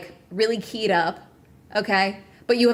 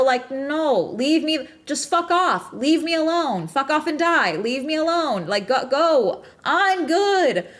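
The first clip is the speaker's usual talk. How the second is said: real, higher-pitched fed-up outburst; agitated; 5 semitones higher, slower than usual. Real, not acted. Streamer telling people meddling in the business to leave, agitated. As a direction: push them away in rising exasperation. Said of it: "I'm fucking agitated".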